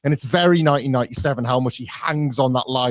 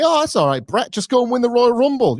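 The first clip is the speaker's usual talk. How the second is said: higher, sing-song mocking brush-off; mock dismissive reassurance; 9 semitones higher, 3 dB louder than usual. Bret mocks bosses telling him to win Rumble, higher sing-song brush-off. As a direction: toss it off like a patronizing boss, pitched up. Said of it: "they tell me".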